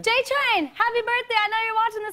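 high, bright birthday shoutout; excited shoutout. Real, not acted. Singer wishes her brother a happy birthday, high and excited. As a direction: beam it out to your little brother.

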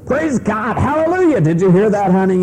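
high, excited hallelujah; gloating Charlie. Retold or imitated. Charlie gloating to his wife, high and excited. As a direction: crow it at your wife in triumph. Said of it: "Charlie says".

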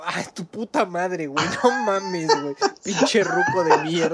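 lento, riendo y subiendo de tono; burla riendo. Retold or imitated. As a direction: se burla del ruco entre risas. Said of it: "Y yo". (risa burlona, lenta y aguda)

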